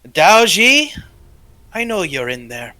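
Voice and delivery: booming name, then a controlled taunt; magically amplified voice. Acted. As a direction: call out to someone hiding inside. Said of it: "magically enhanced voice from outside".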